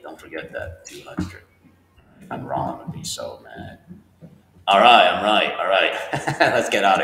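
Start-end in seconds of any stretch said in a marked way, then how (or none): 4.7-7.0 louder, triumphant "I'm right"; teacher relieved the homework answer checks out, cheering; happy relief. Real, not acted. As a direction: celebrate getting the answer right.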